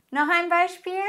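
ordinary talk

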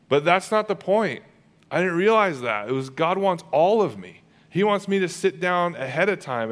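high, loud, driving preacher's voice; full-force preaching. Real, not acted. Preacher calling the congregation, in rising full-force preaching. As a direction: build the sermon to its peak.

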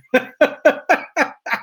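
high, stuttering laugh. Real, not acted. Reacting to hidden microphones joke, high rippling laughter. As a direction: giggle on in high bursts.

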